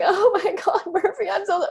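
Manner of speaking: high, gushing goodbye to the dog; mock gushing owner. Owner fussing over her dog at drop-off, high and gushing. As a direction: overdo the tearful goodbye.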